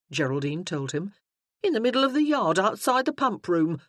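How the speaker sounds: a lady thrilled to tell tales; lightly voiced lady gushing to Will; excited lady. Geraldine tells the sergeant.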